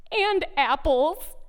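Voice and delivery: slow, a hint of a sniffly little boy; slow mock toddler reply; lightly voiced toddler. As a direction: lightly imitate a toddler, drawing the words out. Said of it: "my son sniffles and he looks up at me and he says".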